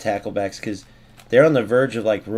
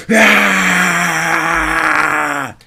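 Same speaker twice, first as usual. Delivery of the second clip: long, high, loud scream of rage; frustrated scream; 7 dB louder than usual. Real, not acted. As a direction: scream it out at the game. Rage outburst at the game.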